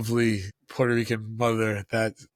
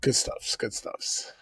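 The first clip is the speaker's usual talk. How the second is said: whispered; 5 dB quieter, slower than usual. Acted. Playful muttered reply.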